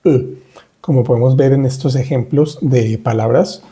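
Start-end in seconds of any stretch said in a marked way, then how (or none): 0.0-0.4 E said as rounded "eu", not Spanish e; isolated rounded E sound; unaccented French E. Shown as a demonstration. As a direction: demonstrate E as the French schwa. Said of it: "sin acentos ni nada se dice".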